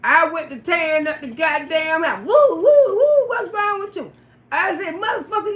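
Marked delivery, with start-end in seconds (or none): 2.2-4.1 startled man. Retold or imitated. Johnny reacts to house wrecked. 4.9-5.6 angry yell. Retold or imitated. Acted quarrel, tearing up house.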